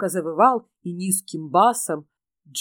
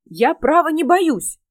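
put-on higher, louder insistent boy; desperate protest; 7 semitones higher, 6 dB louder, slower than usual. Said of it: "вертясь в отчаянии".

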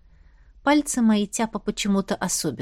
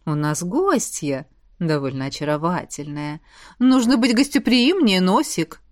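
warm low charm; 5 semitones lower than usual. A narrator's reading. Man speaking charmingly.

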